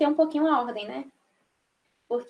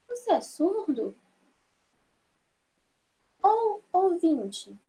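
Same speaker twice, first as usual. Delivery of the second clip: higher, slow questioning; doubtful question; 5 semitones higher, slower than usual. Shown as a demonstration. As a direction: ask with a puzzled tone. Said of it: "a expressão de dúvida". (pergunta com dúvida)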